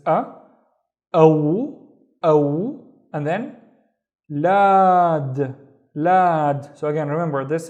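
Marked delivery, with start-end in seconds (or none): 1.1-2.7 aw loud and held as one glide; isolated syllable. Shown as a demonstration. Teacher reading the first syllable, aw loud and separate. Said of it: "Let's read the first syllable". 4.3-6.7 lad loud with a long aa; isolated syllable. Shown as a demonstration. Teacher reading the next syllable, lad held long.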